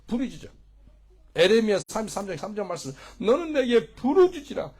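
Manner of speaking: high, loud revival preaching; full-force preaching. Real, not acted. (힘껏 외치는 부흥회 설교)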